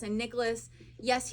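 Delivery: usual speaking voice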